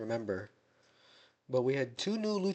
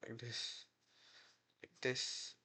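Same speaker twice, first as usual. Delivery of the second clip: quiet muttering; 15 dB quieter, slower than usual. Real, not acted. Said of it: "Is it like this?".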